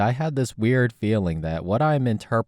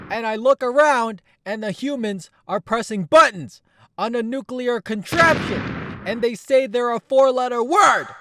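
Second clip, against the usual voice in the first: high, loud outraged rant; furious high rant; 5 dB louder than usual. Acted. Jung yells at typers for twisting his work, high angry voice. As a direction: tear into them for ruining your work. Jung rants at typology fans.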